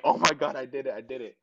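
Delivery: retold breathless-with-joy celebration; elated. Retold joy after winning, hugging coach.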